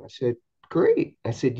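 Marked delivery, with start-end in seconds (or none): 0.7-1.2 one word leaping way up high; host thrilled by mom's conversion, high delighted cry; delighted exclamation. Retold or imitated. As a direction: burst out with joy. Mom now a believer.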